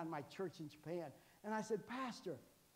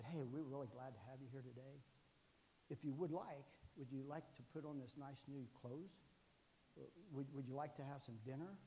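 mock politeness; 5 semitones lower, 10 dB quieter than usual. Mock-polite army welcome.

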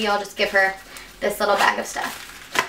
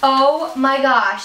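normal talking voice

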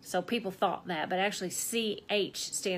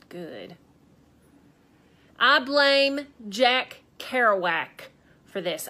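ordinary delivery

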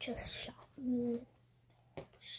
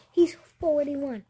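louder; 3 semitones higher, 14 dB louder, slower than usual. Real, not acted. Repeats dad's age louder.

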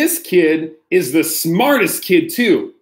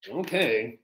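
much lower, soft resigned okay; mock defeat; 7 semitones lower, 12 dB quieter than usual. Reader sighs and gives in to the book, low and soft. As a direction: give up with a deflated okay.

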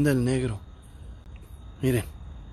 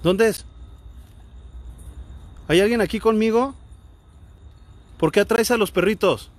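raised, higher-pitched questioning; calling out; 6 semitones higher than usual. Real, not acted. Investigator questioning an unseen presence, calling out.

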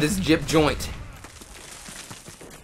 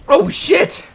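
surprised yelp; 13 semitones higher, 4 dB louder than usual. Real, not acted. Bad hit in game.

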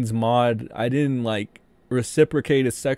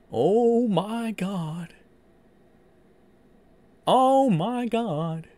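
higher, slow, drawn-out oh my God; stunned disbelief; 8 semitones higher, much slower than usual. Real, not acted. Reading the tweet to chat, slow stunned disbelief. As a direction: let the shock stretch each word.

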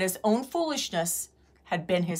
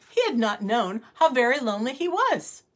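ordinary delivery, nothing marked

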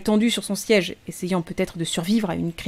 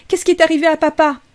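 put-on louder, higher, insistent child's cry; high-pitched cry; 8 semitones higher, 6 dB louder than usual. A narrator's reading. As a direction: demand it in a thin, high child's voice. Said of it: "Sa voix était haut perché, aussi grêle qu'un roseau".

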